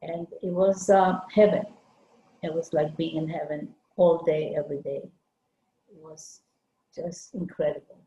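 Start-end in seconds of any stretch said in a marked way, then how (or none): none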